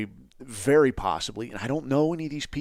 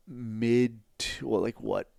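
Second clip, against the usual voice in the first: ordinary talk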